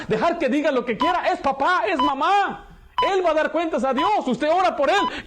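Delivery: impassioned sermon climax; preaching at full force. Acted. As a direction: drive it home from the pulpit.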